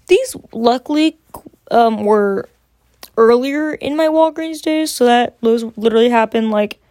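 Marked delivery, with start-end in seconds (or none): none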